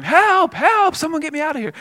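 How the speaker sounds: high, loud panicked cry of help; desperate shouted plea for help; retold shout for help. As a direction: yell it out like a man facing a lion. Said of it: "he just starts shouting".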